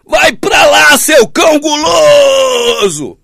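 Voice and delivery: high, very loud shout, slowing on cão guloso; roaring rebuke of a greedy leader, cry in the voice; furious shout. Acted. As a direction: scream the rebuke at the greedy one.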